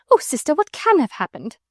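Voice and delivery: high, rising distress; crying out. A narrator's reading. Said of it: "wring her fat hands and cry".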